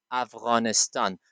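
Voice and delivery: افغانستان with throaty ghayn and long open a's; correct native pronunciation. Shown as a demonstration. Teacher tells students what to always say, correct native pronunciation. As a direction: say افغانستان the local way, "Av-ghaa-nis-taan". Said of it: "You should always say".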